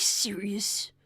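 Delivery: slow hushed whisper; whispered, drawn-out thanks. Real, not acted. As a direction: breathe the thanks out slowly.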